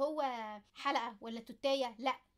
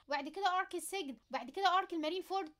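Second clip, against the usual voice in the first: ordinary talk, nothing marked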